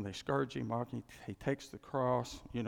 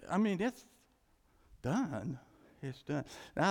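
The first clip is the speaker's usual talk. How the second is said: ordinary talk, nothing marked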